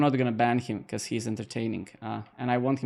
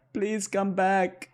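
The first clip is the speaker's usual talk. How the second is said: slow, higher, hammed-up begging; mock pleading; 4 semitones higher, much slower than usual. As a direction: pretend to beg the viewer back. Mock pleading to leaving viewer.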